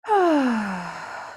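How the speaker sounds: long, falling exhaled sigh; audible sigh. Shown as a demonstration. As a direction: let out a clearing breath as a sigh. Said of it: "a sigh".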